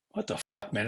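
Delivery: hushed, voiceless curse under the breath; whispered curse. Retold or imitated. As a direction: mutter it to yourself, barely audible. Muttered complaint in cockpit.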